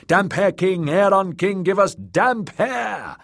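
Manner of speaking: high mob acclamation; crowd cry. Acted. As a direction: clamour for your chosen king. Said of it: "they cried".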